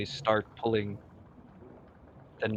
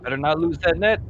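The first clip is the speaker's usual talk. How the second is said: loud, raised yell of alarm; captain yelling; 6 semitones higher, 9 dB louder than usual. Acted. Captain to crew as the rope thrashes, yelling. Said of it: "the captain yelling".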